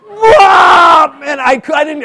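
sudden high yell of pain; hammed-up yell at a fiery pepper; burning pepper shout. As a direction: yelp as the heat hits. Pastor retells eating hot pepper.

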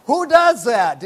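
can't believe what you just saw; spectator's loud bewilderment; incredulous. Retold or imitated. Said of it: "I'm going".

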